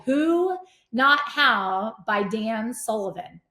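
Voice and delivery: each word slow and spaced out for the listener; slow dictation. Shown as a demonstration. Host telling listeners the book title, slow and clear. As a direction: spell it out slowly so they can write. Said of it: "write this book title down".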